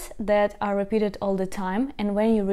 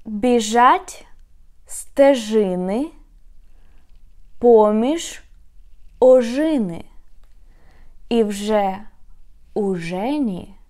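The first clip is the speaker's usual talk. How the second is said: Біжать стежини read word by word, slow and loud; slow, deliberate syllables with long pauses; 4 dB louder, slower than usual. Shown as a demonstration. Teacher reading the tongue twister slowly for learners, word by word. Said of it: "I'm going to read it very slow".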